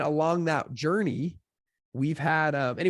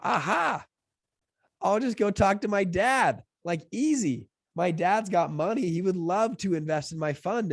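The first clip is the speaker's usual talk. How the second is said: higher, drawn-out cocky realization; retold eureka moment; 6 semitones higher than usual. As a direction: act out thinking it'll be easy. Retold bright idea about funding.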